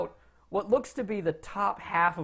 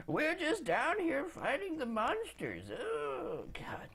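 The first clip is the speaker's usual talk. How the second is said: high, soft, whiny singsong mockery; sarcastic high-pitched grumble; mocking wizard; 8 semitones higher, 10 dB quieter, slower than usual. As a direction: mimic them slowly in a high whine, trailing off quietly. Wizard mocks the adventurers.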